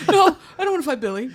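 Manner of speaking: high, fast, laughing student; quick, squeaky mock surrender; student hurriedly giving in, high and fast; mock scared student. Mock student cowering reply.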